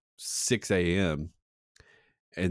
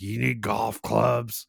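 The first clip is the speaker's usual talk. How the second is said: put-on slow, higher-pitched old-man voice; imitating old regular; 4 semitones higher, much slower than usual. Old man trying to sell teen golf clubs, imitated voice. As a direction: draw out the pitch like an old peddler. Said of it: "or he'd be like".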